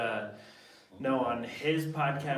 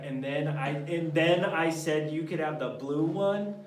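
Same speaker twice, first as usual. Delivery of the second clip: put-on little kid voice; 6 semitones higher, 8 dB louder than usual. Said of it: "goes on like a little kid".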